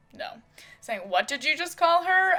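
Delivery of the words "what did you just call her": louder and higher, angry; angry confrontation. Acted. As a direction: confront her for insulting his wife. Robert stands, defends his wife.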